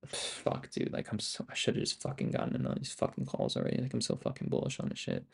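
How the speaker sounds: frustrated whisper under his breath; whispered cursing. Real, not acted. Streamer at his charts, whispering curses. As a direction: curse quietly to yourself.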